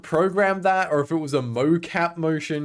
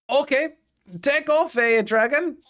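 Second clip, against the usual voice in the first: much higher, squeaky mocking repeat of the line; mock echo; 12 semitones higher, 4 dB louder than usual. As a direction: parrot the grumpy NPC in a high silly voice. Echoes the NPC line mockingly.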